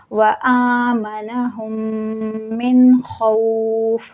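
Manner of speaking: very slow chant stopping on खौफ with sukun; tajweed recitation. Shown as a demonstration. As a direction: draw out व आमनहुम, end खौफ without -in. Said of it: "मिन खौफिन मैं नहीं पढ़ी हूँ". (आयत के आख़िर में खौफ पर सुकून के साथ रुकना)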